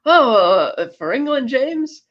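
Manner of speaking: high, halting plea to be spared; pleading Trevelyan. Acted. Trevelyan hoping Bond will spare him, pleading. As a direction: voice a beaten villain asking hopefully. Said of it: "seemingly expecting Bond to spare him".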